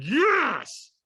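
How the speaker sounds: high, loud retold yes; forceful yell. Walker answering trainer's demand for stronger, forceful yell. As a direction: yell it back, stretched out. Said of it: "And I went".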